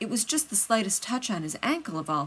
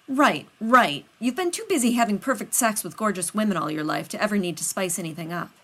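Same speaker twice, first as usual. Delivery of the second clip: loud sarcastic right right; 3 semitones higher, 6 dB louder than usual. Acted. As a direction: snap it back sarcastically. Rodney snaps sarcastically.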